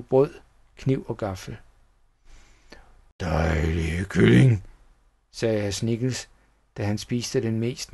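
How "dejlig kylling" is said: low, drawn-out villain voice; relishing villain. A narrator's reading. Schnickels eating his chicken, relishing it. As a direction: savour each word slowly in a deep voice.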